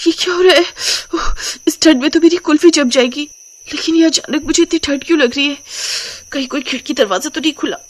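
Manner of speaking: high, chattering bride voice with shuddering breaths; cold-struck character voice, shuddering between phrases; shivering with cold. Acted. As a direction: act freezing awake, pitched well up. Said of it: "अचानक अंजलि ठंड के मारे कांपने लगती है".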